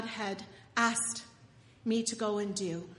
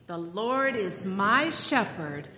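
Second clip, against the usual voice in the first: slow, louder recitation for the congregation to echo; 3 semitones lower, 4 dB louder, slower than usual. Shown as a demonstration. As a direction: lead the people in the psalm, slowly. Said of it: "Say it with me".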